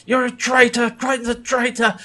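high, shrill accusing cry; imitated yell. Kelsa accusing Crichton after shooting Grimes, yelling. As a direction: hurl the accusation. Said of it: "She's like".

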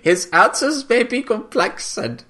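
hammed-up Austrian German accent, starting high; Harvey. Co-host carrying on Harvey's speech about Scorpius, bad Austrian German accent. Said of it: "like, Austrian German accent".

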